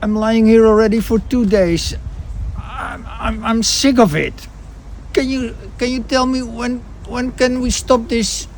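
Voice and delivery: put-on high, loud complaining old patient voice; whiny high patient. Patient in salon chair complains loudly in high voice. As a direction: moan like a fed-up invalid.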